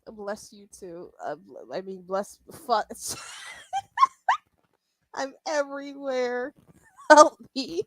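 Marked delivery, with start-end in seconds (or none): 2.9-4.4 high laughing shriek. Real, not acted. Laughing after flubbing words. 5.1-7.8 laughing. Real, not acted. Laughing at own flub.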